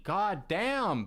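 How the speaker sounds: normal talking voice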